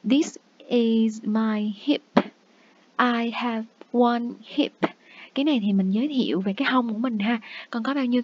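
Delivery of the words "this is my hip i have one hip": put-on very slow, each word spaced out for children; slow, clear teacher demo; slow model sentence. Shown as a demonstration. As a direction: model it slowly for young learners. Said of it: "Ví dụ như là".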